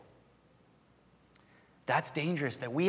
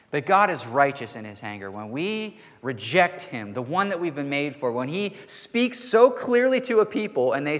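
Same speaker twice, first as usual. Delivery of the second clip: loud, raised-pitch sermon delivery building to a peak; impassioned preaching; 4 semitones higher, 7 dB louder than usual. Real, not acted. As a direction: preach it with mounting force to the congregation. Preaches at rising full force.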